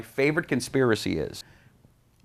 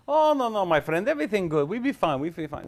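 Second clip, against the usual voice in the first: high, eager broken English; Middle Eastern accent; 7 semitones higher than usual. Retold or imitated. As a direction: imitate the smooth fixer. Fixer's reply in broken English.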